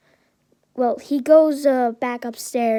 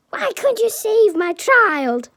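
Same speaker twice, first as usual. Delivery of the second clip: high, loud accusing shout; very mad yell; 10 semitones higher, 7 dB louder than usual. A narrator's reading. Mad Inko with sandal in hand, furious yelling. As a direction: scream it as an outraged mother. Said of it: "with a very mad Inko".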